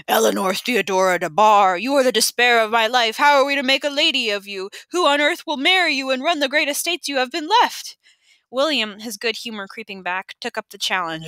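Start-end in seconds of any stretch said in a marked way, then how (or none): 0.1-8.0 lightly voiced stern father imitation, hammed-up; a girl playfully mimicking her guardian's scolding; mock stern father. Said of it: "made her voice deep and gravelly".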